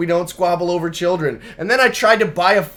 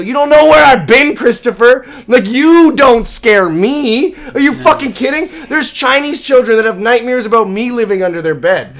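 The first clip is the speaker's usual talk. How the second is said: mock taunting yell; 5 semitones higher, 5 dB louder than usual. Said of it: "spit blood in your face and go".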